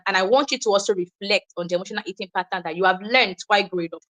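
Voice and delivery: ordinary talk, nothing marked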